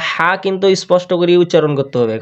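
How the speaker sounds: ordinary delivery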